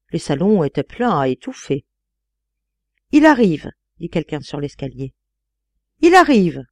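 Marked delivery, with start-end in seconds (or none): 3.1-3.7 loud heralding cry, falling at the end; announcing call. A narrator's reading. Someone on the staircase announcing the guest, calling out. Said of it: "dit un autre un peu plus haut". 6.0-6.6 raised cry, high and loud; louder announcing call. A narrator's reading. Someone further up announcing the guest, louder call. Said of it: "dit un autre un peu plus haut".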